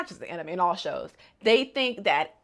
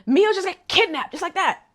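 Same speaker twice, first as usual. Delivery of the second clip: shocked; 9 semitones higher than usual. Real, not acted. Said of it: "I'm like".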